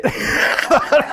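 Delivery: breathy burst of laughter; real laugh. Real, not acted. Pastor laughing at the congregation's story. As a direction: laugh at the absurdity.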